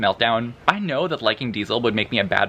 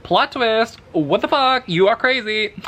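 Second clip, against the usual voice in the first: high-pitched mock disbelief; mock-incredulous, high-voiced quote; acted shocked reaction; 10 semitones higher than usual. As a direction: play her astonished, pitched way up.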